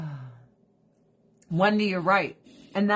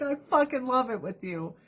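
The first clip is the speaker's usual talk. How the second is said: acted gushing in a raised voice; high gushing; 5 semitones higher than usual. Replying to friend about shared food, high mock gushing. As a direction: gush back, pitched high.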